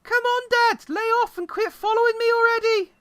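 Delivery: high, loud whiny daughter; bratty teen girl. Pretend. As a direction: complain at your dad up high. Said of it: "that voice suits her perfectly".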